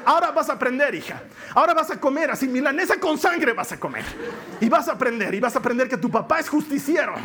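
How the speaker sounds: play-acted punishing rant; mock stern father. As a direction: scold like a vengeful dad, hammed up. Acting a punishing father scolding his daughter.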